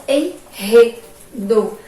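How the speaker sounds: "enredo" slow and loud, split en, re, do; strong syllable-initial R. Shown as a demonstration. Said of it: "iniciada com a letra R forte".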